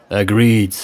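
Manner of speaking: ordinary delivery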